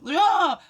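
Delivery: sudden high loud yelp of delight; excited cry. Real, not acted. Reacting to cards falling, sharp excited cry. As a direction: let out a burst of excitement.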